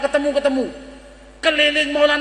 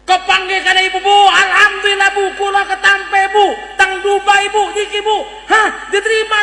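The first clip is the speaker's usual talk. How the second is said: high-pitched, elated outpouring of news; excited announcing; 5 semitones higher than usual. Acted. Son tells mother of the Dubai job, excited. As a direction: pour out good news at a raised pitch.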